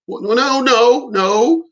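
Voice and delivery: startled protest. Retold or imitated. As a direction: act out a taken-aback refusal, with emphasis. Acted protest to a girlfriend.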